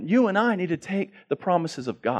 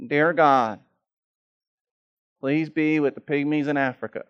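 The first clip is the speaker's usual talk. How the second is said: mock rote prayer; 2 semitones higher, 5 dB louder than usual. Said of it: "what you and I mostly do".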